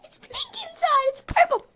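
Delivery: high, loud, laughing alarm; excited. Real, not acted. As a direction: squeal it in real disgust. Horrified by flavor.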